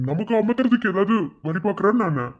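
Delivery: normal talking voice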